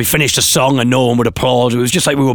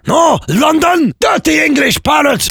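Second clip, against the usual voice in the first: very high, outraged French officer; French language police; 4 dB louder, slower than usual. Acted. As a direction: scold the parrot in a hammed-up French fury. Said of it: "imagine the language police turning up".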